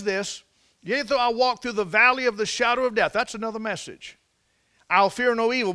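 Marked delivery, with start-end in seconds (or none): 0.8-3.1 preached psalm. Acted. Preaching psalm at full force. 4.9-5.7 preached psalm. Acted.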